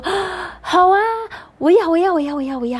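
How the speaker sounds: overjoyed little kangaroo. A narrator's reading. Little kangaroo eagerly accepting invitation to join, thrilled and high. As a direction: squeal it like a thrilled child. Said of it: "小袋鼠就说".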